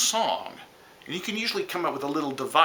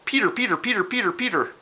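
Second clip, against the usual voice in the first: higher, repeated bird whistle; titmouse call imitation; 8 semitones higher, faster than usual. Teacher imitating the tufted titmouse, higher repeated call. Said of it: "it sounds like it says".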